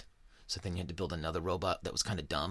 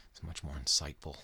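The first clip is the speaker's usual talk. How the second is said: ordinary talk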